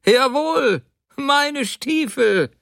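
high, emphatic outburst; angry insistence. A narrator's reading. Husband insisting they are his boots, angrily. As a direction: hammer it home, indignant.

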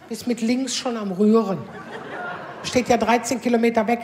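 ordinary talk

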